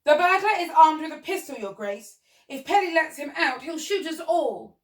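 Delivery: high, loud warning; alarmed shout. Acted. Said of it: "The giraffe shouted".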